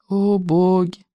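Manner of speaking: slow drawn-out groan. A narrator's reading. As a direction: moan it out slowly. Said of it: "простонал".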